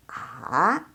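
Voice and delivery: A low, dipping then rising; third tone. Shown as a demonstration. Teacher reviewing tone three, A low and dipping. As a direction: dip A down and back up. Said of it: "ketiga itu".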